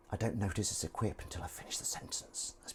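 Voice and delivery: whisper. Real, not acted. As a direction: whisper it like a secret.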